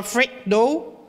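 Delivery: ordinary talk, nothing marked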